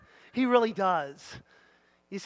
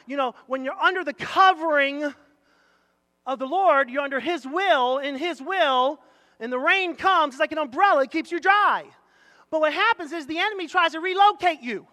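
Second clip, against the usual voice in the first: raised, loud illustrative preaching; forceful preaching; 7 semitones higher, 8 dB louder than usual. Real, not acted. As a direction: drive the picture home to the congregation.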